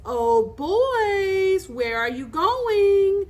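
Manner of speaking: high, loud singsong taunt; shrill, girlish voice. A narrator's reading. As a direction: call out mockingly like a little girl. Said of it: "calling in a shrill, girlish voice".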